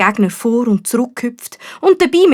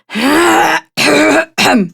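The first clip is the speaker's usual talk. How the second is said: exaggerated throat clear; loud throat clearing; 7 semitones higher, 4 dB louder than usual. A narrator's reading. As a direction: clear the throat loudly for attention. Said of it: "räuspert sich laut". (lautes Räuspern)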